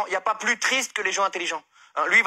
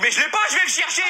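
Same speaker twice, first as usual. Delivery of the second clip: exasperated protest; 8 semitones higher, 4 dB louder than usual. Retold or imitated. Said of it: "Je dis".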